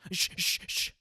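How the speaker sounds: quick breathy shushes with a foot stomp; shushing a cat. Retold or imitated. Mimicking people shooing a cat off with a stomp, breathy shushes. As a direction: hiss the cat away. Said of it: "And you stop your foot".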